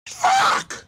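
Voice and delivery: sharp, high curse; pissed off. Real, not acted. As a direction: spit out one frustrated swear. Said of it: "I'm pissed!".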